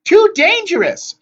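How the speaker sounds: slow, very high, loud protest; wailing. A narrator's reading. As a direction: wail the warning long and high. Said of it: "the Gray sisters wailed".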